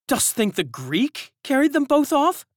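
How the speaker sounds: high, loud questioning woman; high anxious servant. A narrator's reading. Servant asks the others about the nurse, high and loud. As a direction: ask in worried alarm.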